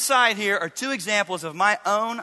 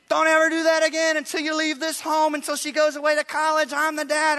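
much higher, louder furious mock yell; retold angry yell; 9 semitones higher, 3 dB louder than usual. Father yelling at his son on the stairs, furious mock yell. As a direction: roar it at the son, nose to nose. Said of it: "raised voice, spittle flying".

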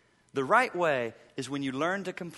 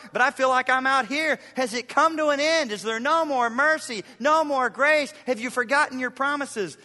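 higher, louder, pleading voice; impassioned scripture reading; 7 semitones higher, 6 dB louder than usual. Acted. Pastor voicing the psalmist's anguished questions, impassioned.

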